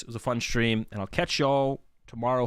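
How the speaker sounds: usual speaking voice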